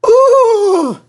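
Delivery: very high, loud hammed-up ooh; groaning carriage complaint; squeaky loud coach moan; coaches' groan. A narrator's reading. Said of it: "grumbled the coaches".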